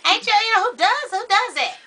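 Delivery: high, incredulous rant; exasperated disbelief. Real, not acted. Still ranting about the hooves.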